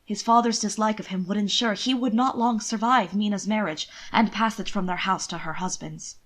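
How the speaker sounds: ordinary talk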